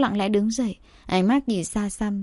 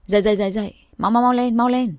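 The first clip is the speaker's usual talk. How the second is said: hurried, raised-voice wake-up call; urgent calling out; faster than usual. A narrator's reading. Flustered roommate waking the others, calling out urgently. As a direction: rouse them with quick repeated cries. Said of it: "vừa la lớn".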